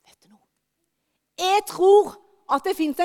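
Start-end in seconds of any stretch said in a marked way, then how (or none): 0.1-0.4 hushed secret question; whisper. Real, not acted. Preacher whispering to the congregation. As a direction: lean in and whisper it.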